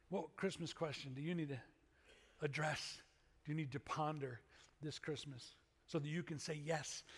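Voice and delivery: normal talking voice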